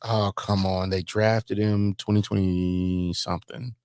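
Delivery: normal talking voice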